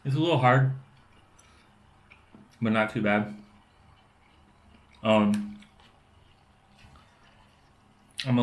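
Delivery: words muffled by chewing; talks while chewing potato. Real, not acted. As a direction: mouth partly full of food. Seen 1: Takes potato bite at 1.1, then jaw moves chewing while talking.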